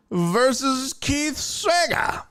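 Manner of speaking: slow, high-pitched matchup call; announcer. Acted. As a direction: stretch the name out like a ring announcer.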